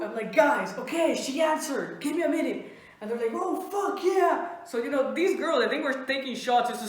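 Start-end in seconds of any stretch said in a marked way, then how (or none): 0.3-2.7 slightly raised, eager quote; retold excitement. Telling friends she answered, excited. 3.3-4.6 high, drawn-out cheer; cheering friends. Retold or imitated. Friends celebrating the reply, excited.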